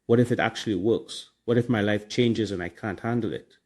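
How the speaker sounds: ordinary delivery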